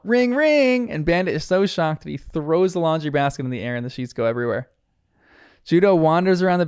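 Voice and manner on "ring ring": much higher, loud call of ring ring; screamed out. Acted. Judo calls out to the hiding girls, screaming. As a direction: scream it out like a phone. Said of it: "She screams out".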